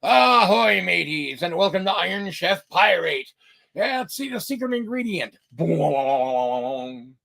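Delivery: hammed-up pirate TV host; pirate show host. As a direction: present it like a game show pirate. Iron Chef Pirate host.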